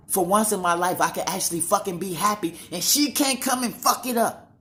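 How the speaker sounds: building to a high, loud cry of relief; rising outburst. Real, not acted. Son crying out his relief at escaping mother, rising loud.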